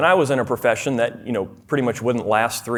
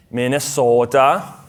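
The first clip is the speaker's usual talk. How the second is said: Minnesota accent; slower than usual. Shown as a demonstration. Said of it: "reminded of my accent".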